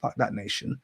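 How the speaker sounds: whispered curse under the breath; hushed aside. Retold or imitated. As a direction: hiss it barely audible. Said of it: "I'm like".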